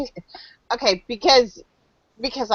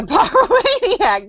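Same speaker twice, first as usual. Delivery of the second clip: laughing; 12 semitones higher, slower than usual. Real, not acted. Laughing at the pyromaniac answer.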